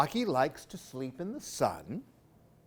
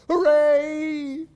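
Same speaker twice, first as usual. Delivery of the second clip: loud, high joyful cheer; shouted hooray; 10 semitones higher, 10 dB louder than usual. Acted. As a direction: cheer like waking up to spring. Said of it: "he shouted".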